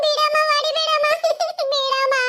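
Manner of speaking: acted sobs between begging words; sobbing plea. Child being beaten begs mother, sobbing. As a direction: sob and beg to be let go.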